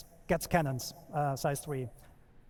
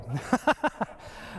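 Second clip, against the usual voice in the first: surprised laugh; 2 semitones higher than usual. Real, not acted. Presenter surprised by broken demo.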